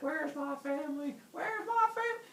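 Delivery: high frantic wail, rising on the repeat; freaking out. Retold or imitated. Heracles chopping at monsters, high frantic demand. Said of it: "He freaks out".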